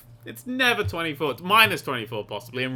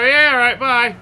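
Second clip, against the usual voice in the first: careless shrug; 8 semitones higher, 11 dB louder than usual. Retold or imitated. Acted careless driver.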